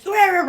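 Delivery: high, loud growling yell; mock angry scream. Man in car screaming at her in Koreatown, mock scream. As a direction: imitate wordless shouting from a car. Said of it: "screaming at me".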